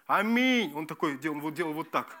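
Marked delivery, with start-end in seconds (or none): 0.1-0.7 loud, higher, drawn-out cry of АМИНЬ; shouted amen. Retold or imitated. Preacher showing how he shouted in church, loud cry. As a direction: re-enact shouting out in worship. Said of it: "когда я кричал".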